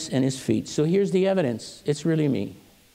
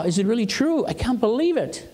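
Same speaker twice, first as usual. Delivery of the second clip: higher-pitched, amazed voice; awed disbelief; 6 semitones higher than usual. Acted. Jacob told his son is still alive, awed disbelief. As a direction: act out overwhelmed wonder, pitch lifted. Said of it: "He was paralyzed with awe".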